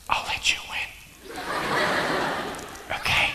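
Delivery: soft whispered aside; a private whispered aside. Real, not acted. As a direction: whisper it just to him. Aside to the volunteer.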